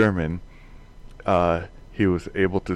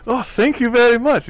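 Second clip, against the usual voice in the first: much higher, louder mock German voice; German accent; 7 dB louder than usual. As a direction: chirp it in a hammy German accent. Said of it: "German-accent joke retold".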